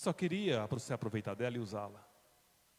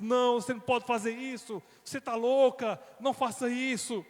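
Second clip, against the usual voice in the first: high, loud desperate begging; desperate plea; 10 semitones higher, 5 dB louder than usual. Acted. Husband pleading with his leaving wife, desperate. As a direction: beg her not to go. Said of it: "colocou a mão na cabeça e disse".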